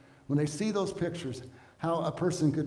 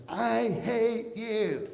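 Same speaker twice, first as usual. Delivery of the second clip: mimicking a child; 5 semitones higher, much slower than usual. Child's tantrum quoted.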